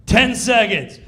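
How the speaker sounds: loud countdown shout; countdown call. Retold or imitated. Stage manager counting down, loud call. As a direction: bark the time left.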